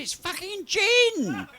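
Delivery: high, slow, loud outburst; outraged shout. Retold or imitated. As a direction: blurt out the discovery, scandalised. Officer tastes the holy water.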